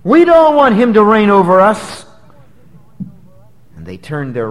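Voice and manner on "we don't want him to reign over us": preacher voicing the crowd rejecting Jesus; angry crowd. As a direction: throw the rejection back as the mob. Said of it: "They're saying".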